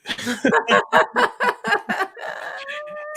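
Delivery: long, high rippling laugh. Real, not acted. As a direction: burst into a high laugh that tails off. Delighted by the story's outcome.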